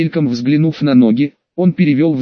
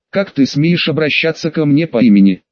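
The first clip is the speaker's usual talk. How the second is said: plain reading voice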